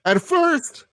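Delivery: usual speaking voice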